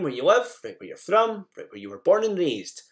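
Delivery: ordinary talk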